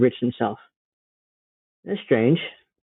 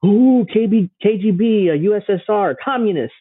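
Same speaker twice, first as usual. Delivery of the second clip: mock-spooky alarm in a higher voice; mock scare; 7 semitones higher than usual. Host mimicking media scaring viewers about Russia, mock-frightened. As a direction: wail it like a bogeyman warning. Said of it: "like you're trying to scare us".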